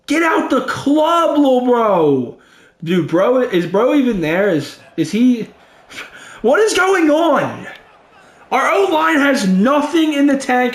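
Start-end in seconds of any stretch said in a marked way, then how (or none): 0.1-2.4 high, slow, drawn-out taunting voice; mock trash talk. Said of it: "He said". 6.4-10.7 exasperated outburst. Real, not acted. Frustrated at the offensive line.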